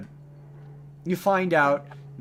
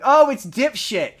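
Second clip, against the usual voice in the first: mocking greeting; 9 semitones higher, 9 dB louder, slower than usual. Mock greeting of the character.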